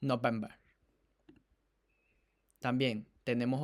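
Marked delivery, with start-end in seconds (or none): 0.0-0.5 "November" said like "nobembe", final r dropped; mock-accented "November" with no final r; mimicked accent. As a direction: echo "November" as "nobembe", mocking her accent. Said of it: "otro acento de Kamala".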